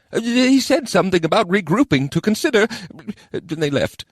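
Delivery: very high, squeaky goblin report; goblin adviser. A narrator's reading. Goblin reporting the elves' departure, squeaky. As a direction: squeak out the news as a little goblin.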